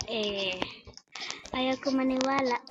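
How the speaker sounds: usual speaking voice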